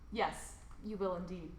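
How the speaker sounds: ordinary delivery, nothing marked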